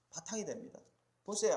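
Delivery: normal talking voice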